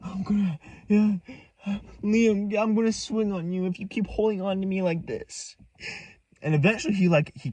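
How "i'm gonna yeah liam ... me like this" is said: high, strained mock-dramatic warning; movie-style threat. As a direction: imitate an overwrought movie character. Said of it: "like he was from a movie".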